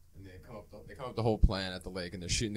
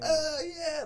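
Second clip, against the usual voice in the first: very high, loud oh yeah; mock impressed oh yeah; 6 dB louder than usual. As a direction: squeal it out impressed.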